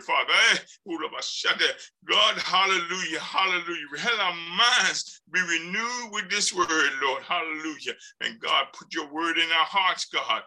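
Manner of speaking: fervent spoken prayer. Real, not acted. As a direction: pray aloud to the congregation.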